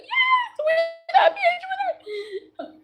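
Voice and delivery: high squealing fan cheer, slowing down; imitated crowd scream. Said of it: "everybody get to scream".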